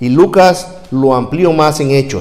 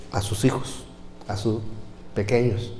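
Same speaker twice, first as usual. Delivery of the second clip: softer, unvoiced murmur; tender whisper; 3 semitones lower, 14 dB quieter than usual. Real, not acted. Tender blessing. (susurro tierno)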